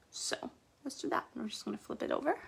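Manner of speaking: whispered aside. Real, not acted. Nail tutor whispering to herself as she flips her hand. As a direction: drop to a whisper mid-tutorial. Quiet aside while removing nails.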